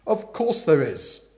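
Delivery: loud, forceful Aunt Dahlia voice; bossy aunt telling Bertie the gossip, loud and brisk; booming aunt. Acted.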